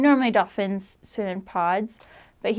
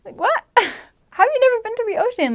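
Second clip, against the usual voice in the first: incredulous; 13 semitones higher, 4 dB louder than usual. Real, not acted. Incredulous at the question.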